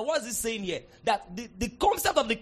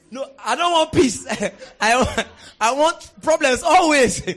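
louder, laughing mock of a trouble-seeker; 4 semitones higher, 10 dB louder, slower than usual. Preacher mocking people who want problems, laughing. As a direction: laugh while playing them.

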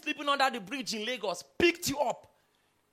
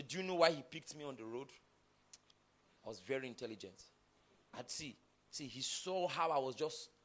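a hint of a smug boaster, much softer and lower; mock bragging; 8 semitones lower, 10 dB quieter than usual. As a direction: sneer it like a show-off to his mates. Mocking a boastful beneficiary.

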